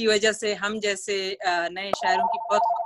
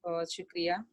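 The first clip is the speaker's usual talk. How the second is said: ordinary talk, nothing marked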